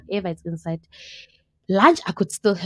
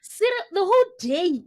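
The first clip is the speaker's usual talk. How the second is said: incredulous; 10 semitones higher than usual. Real, not acted. Mocking disbelief at cereal diet.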